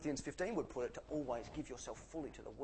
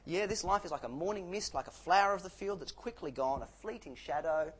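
ordinary talk, nothing marked

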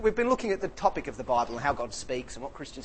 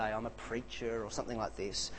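sheepish, softer; 6 semitones lower, 10 dB quieter than usual. Retold or imitated. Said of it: "if I'm a bit more chicken".

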